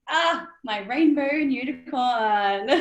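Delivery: slow, drawn out through a laugh; laughing fondly. Real, not acted. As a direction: laugh while praising her. Fondly naming her assistant Missy.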